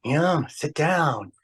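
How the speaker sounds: lightly imitated old-time tough guy, slow and halting; slow, drawn-out commands with long gaps; a hint of an old star's impression; James Cagney. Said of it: "And he said".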